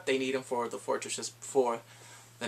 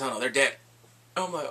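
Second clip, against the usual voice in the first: ordinary talk, nothing marked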